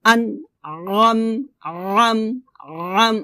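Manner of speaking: slow "আনআম" with squeezed throat on "আম"; pressed ayn. Shown as a demonstration. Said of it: "আমার সাথে বলেন".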